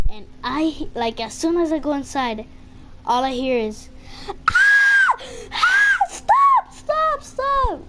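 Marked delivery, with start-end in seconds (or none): 4.3-7.8 high-pitched screams, pleading stop; screaming. Retold or imitated. Stepson mimics what he heard going inside, screaming. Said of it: "just screaming all over".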